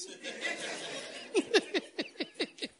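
long high breathy laugh; wheezy laugh. Real, not acted. Pastor tickled after teasing angry listeners, wheezing laugh.

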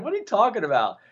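high-pitched retold incredulous protest; amused disbelief. Retelling his reply about the Jersey accent, amused disbelief. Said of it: "I started laughing. I said".

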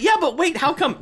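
high-pitched, bewildered objection; confused protest. Acted. Confused viewer questioning a movie that won't explain, protesting. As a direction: try to get a question in, voice jumping up.

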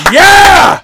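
loud, high drawn-out yell; over-the-top celebratory shout; whooping cheer. Real, not acted. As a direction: holler it in triumph. Cheering the movie title being said.

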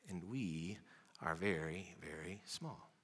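very small fading almost to nothing; hushed small. Retold or imitated. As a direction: make the voice tiny and slow on very small. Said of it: "she did it again for emphasis".

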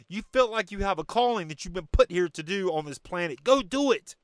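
normal talking voice

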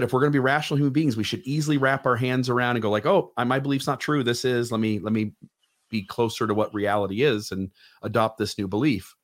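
usual speaking voice

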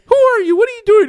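very high, loud panicked questions; hammed-up alarmed shriek; shrieking woman. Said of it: "she's like".

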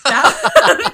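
a loud, high real laugh bursting out; high burst of laughter. Real, not acted. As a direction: break into loud laughter at yourself. Laughs at her own panic.

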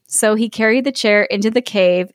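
ordinary delivery

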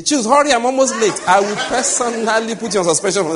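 hammed-up impatient call to husband; mock wife shouting. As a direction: bark the hurry like a rushed wife. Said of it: "If I hear you say".

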